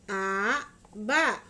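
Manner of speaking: A and ba each said loud and slow, apart; over enunciating. Shown as a demonstration. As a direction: sound out each syllable, A then ba. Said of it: "ikutin Mama ya".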